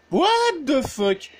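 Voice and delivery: startled outburst at a jump scare; scared horror game streamer. Real, not acted. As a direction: blurt it out, caught off guard. Jump scare in haunted map.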